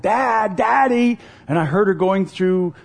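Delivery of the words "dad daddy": raised, higher-pitched call; mock child's scream for Dad. Youngest daughter hunting for her dad through the house, imitated screaming. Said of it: "screaming for Dad".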